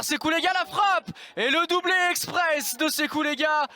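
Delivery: building excitement on the goal; excited goal call. Real, not acted. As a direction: commentator lifts for the goal.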